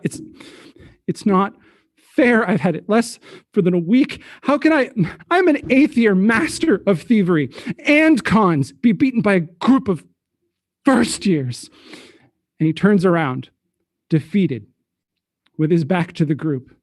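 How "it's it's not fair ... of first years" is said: higher, halting, flustered protest; stammering outburst. Acted. Thief beaten by first years, stammering in disbelief. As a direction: stammer it out as composure slips. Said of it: "he stammers out".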